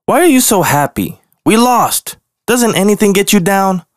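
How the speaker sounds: high, exasperated cub voice; glaring grumpy bear. Acted. Grumpy bear snapping at the smiling skunk. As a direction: demand it, fed up with his cheerful friend. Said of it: "Grunt glared".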